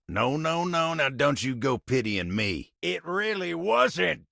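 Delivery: high, insistent denial; flustered protest. Acted. Refusing pity, protesting.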